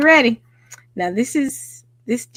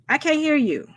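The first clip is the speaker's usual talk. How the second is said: ordinary talk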